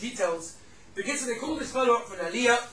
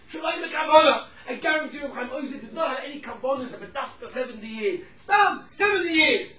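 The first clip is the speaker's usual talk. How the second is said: loud, high sermon climax; shouted exclamation, raised pitch; impassioned preaching; 5 semitones higher, 7 dB louder than usual. Real, not acted. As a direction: drive the point home at full force. Preacher drives home story's moral.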